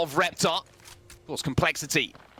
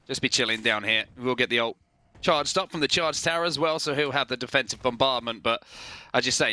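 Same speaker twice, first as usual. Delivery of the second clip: normal talking voice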